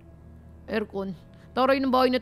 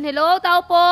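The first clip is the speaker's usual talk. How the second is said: loud hail to whoever is inside; calling out; 13 dB louder than usual. Pretend. Visitor announcing themselves at the door, calling out. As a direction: project it into the house. Calls into the empty house.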